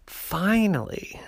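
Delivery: plain reading voice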